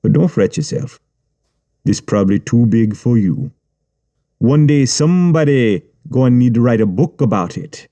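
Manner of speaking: Jamaican accent, mocking and unhurried; Jamaican gangster. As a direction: brush him off with a smirk. Gangster taunting the writer.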